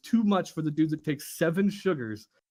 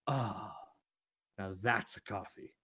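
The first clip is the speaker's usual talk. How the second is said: low, soft, contented savouring; satisfied sigh; 10 semitones lower, 11 dB quieter than usual. Retold or imitated. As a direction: sigh it out like the perfect sip. Boss finally satisfied sip.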